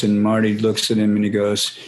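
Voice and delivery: ordinary talk, nothing marked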